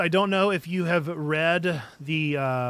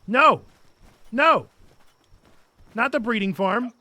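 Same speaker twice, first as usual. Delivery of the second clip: much higher, louder whiny critter; squeaky creature voice; 9 semitones higher, 7 dB louder than usual. Pretend. Gamer voicing animals refusing the ranch, squeaky and indignant. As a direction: play an outraged little creature.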